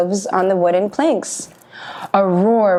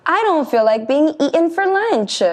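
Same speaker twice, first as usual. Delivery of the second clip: high goat voice; 8 semitones higher than usual. Acted. Worried middle goat voiced.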